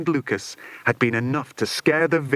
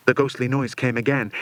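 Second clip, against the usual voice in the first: plain reading voice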